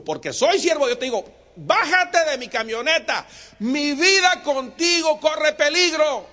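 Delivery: loud, slow pastor's command; loud order. Acted. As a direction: hammer each word home. Wensmayer orders him out.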